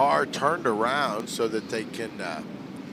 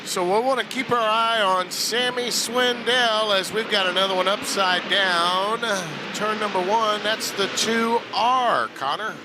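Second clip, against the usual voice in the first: higher, louder urgent play-by-play; excited race call; 8 semitones higher, 3 dB louder than usual. Real, not acted. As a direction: call the flip with rising urgency. Caller reacts to another flip.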